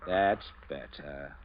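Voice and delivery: slow satisfied. Acted. Captain forced at gunpoint.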